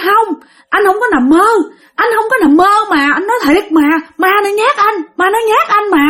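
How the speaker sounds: high, pleading protest; frightened insisting. Acted. Father insists to wife he wasn't dreaming, high frightened protest.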